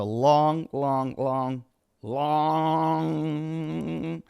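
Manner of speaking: "long" stretched longer each time; drawn-out emphasis. Acted. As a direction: hold the last "long" endlessly on one level note. Jokes about lengthy list of dates.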